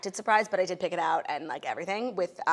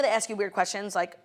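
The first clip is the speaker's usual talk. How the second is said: usual speaking voice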